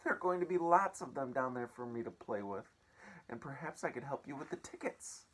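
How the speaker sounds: put-on pleading boy. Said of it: "he had begged".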